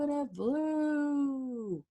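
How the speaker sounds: stretched very slow finish; slow drawn-out. A narrator's reading. Reader slowly draws out the final flight. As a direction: stretch "flew" out long.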